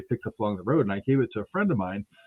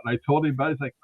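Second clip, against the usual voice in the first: usual speaking voice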